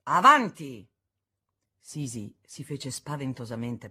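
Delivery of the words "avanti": sharp loud command to start the duel; signal shout. A narrator's reading. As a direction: bark the start like a second giving the signal. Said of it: "Régimbard diede il segnale".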